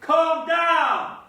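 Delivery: very loud, high, impatient holler; full-throated yell. Acted. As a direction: bellow it upstairs at full force. Repeats the call after "What?.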